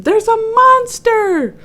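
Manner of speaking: slow, high, loud cry of alarm. A narrator's reading. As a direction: wail it out in fright. Birds calling a warning.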